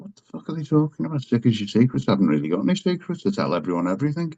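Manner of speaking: rattled off fast, baffled; quick, dismissive inner voice; fast inner thoughts. Retold or imitated. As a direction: rush it out like a scornful thought. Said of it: "I thought".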